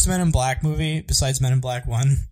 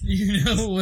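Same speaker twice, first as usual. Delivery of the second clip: higher mock impression, echoing the old-man bit; Adam West; 6 semitones higher, slower than usual. Echoing a friend's hand-signal joke, hammed-up impression. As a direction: put on a pitched-up character voice. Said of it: "That actually felt more like Adam West".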